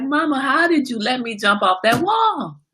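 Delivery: high mock wail to Mama; imitated puppy crying. Owner voicing her crying puppy to Mama, mock wailing complaint. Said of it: "He's crying like".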